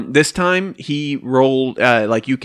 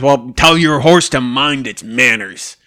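louder put-on character voice; gruff NPC voice; 7 dB louder than usual. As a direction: scold as a grumpy NPC.

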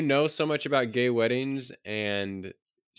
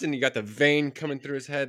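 normal talking voice

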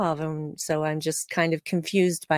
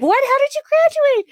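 very high, louder mock outrage; shocked disbelief; 6 dB louder than usual. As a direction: gape at her in disbelief. Rutherford voiced, shocked.

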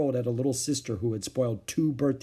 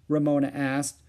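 usual speaking voice

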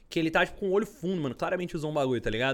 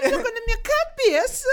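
put-on very high, whiny ghost girl; 6 dB louder than usual. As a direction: wail it in a shrill ghost girl's voice. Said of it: "Morta que geme".

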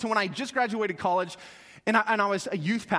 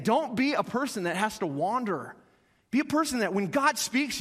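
normal talking voice

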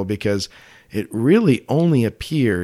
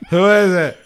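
put-on slurred, louder drunk uncle voice; 8 semitones higher, 3 dB louder than usual. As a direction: play a pushy drunk uncle. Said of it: "like a drunk uncle".

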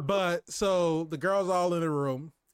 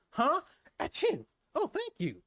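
high mock sneeze; 11 semitones higher than usual. Acting out Anya sneezing.

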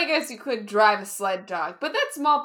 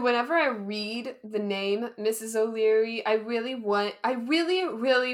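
ordinary talk, nothing marked

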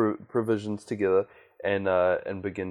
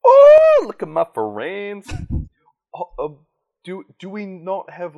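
surprised shout; 10 semitones higher, 12 dB louder than usual. Acted. Player character shouts in surprise.

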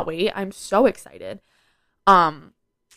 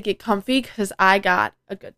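ordinary talk

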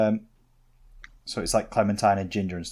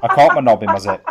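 laughing through the line; 9 dB louder than usual. Real, not acted. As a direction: repeat it, cracking up at yourself. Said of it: "repeated for effect, with a laugh".

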